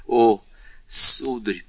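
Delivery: plain reading voice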